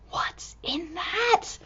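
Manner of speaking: slow hushed whisper. Acted. Reader to children, slow whisper. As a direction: ask it secretly.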